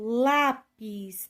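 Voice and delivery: lápis slow, with a strong long LÁ; penultimate stress demo. Shown as a demonstration. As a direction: push the LÁ in lápis, then PIS. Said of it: "paroxítonas acentuadas terminadas em I".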